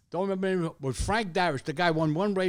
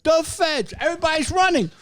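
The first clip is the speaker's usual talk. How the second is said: much higher, louder panic; excited; 14 semitones higher, 10 dB louder than usual. Retold or imitated. Recalling the feds arriving, in a high, loud rush. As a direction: rattle it off like the raid is happening. Police raid chaos retold.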